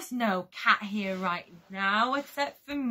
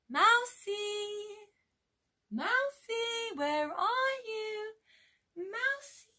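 high, drawn-out playful call; sing-song calling; 8 semitones higher, 5 dB quieter, slower than usual. Pretend. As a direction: coax a hiding pet out, high-pitched. Calling a hidden mouse friend.

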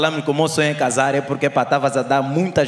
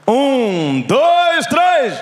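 counting in louder and higher each number; rising count; 10 semitones higher, 4 dB louder, much slower than usual. Real, not acted. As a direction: whip the crowd up on the count. Counting crowd in loudly.

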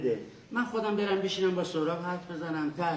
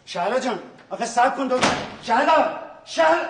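calls building from loud to a high yell; calling after her; 6 semitones higher, 6 dB louder than usual. Acted. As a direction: shout her name as she storms out. Calling after leaving wife.

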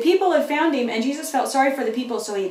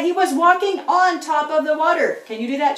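ordinary talk, nothing marked

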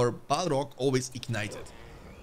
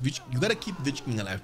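usual speaking voice

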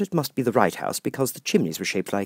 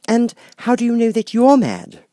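put-on curious young Alice; 9 dB louder than usual. Alice asks the cat.

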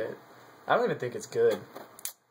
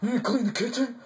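much higher put-on wife voice; mock bossy wife; 10 semitones higher, faster than usual. Teasing partner as a bossy wife, high mocking voice. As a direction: imitate a domineering wife, pitched way up. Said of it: "You're like".

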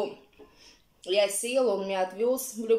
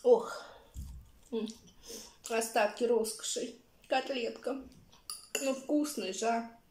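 talking while chewing; eating between words; mouth full; 4 dB quieter than usual. Real, not acted. Seen 2: fork to mouth, visibly chewing while talking throughout.

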